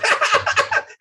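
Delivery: high loud laugh; burst of laughter. Real, not acted. Laughing as he tells the audience. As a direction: laugh out at the memory.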